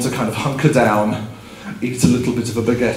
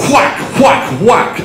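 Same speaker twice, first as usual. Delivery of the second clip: loud, higher whacks; imitated crashing; 10 semitones higher, 3 dB louder, much slower than usual. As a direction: punch each whack like splintering wood. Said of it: "which was basically like".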